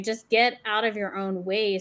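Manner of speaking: ordinary talk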